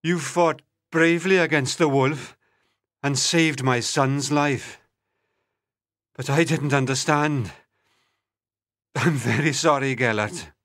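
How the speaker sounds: deep, low voice of sorrow; grieving prince. A narrator's reading. Prince apologising to his dead dog, mournful. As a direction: apologise low, with understated grief.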